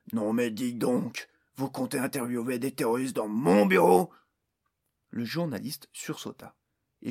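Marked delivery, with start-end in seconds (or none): 0.1-4.1 snarling with spite. A narrator's reading. Orc boss turning on the journalist, spiteful snarl. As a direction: spit it at the reporter with contempt. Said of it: "avec hargne lança".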